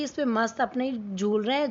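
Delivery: ordinary delivery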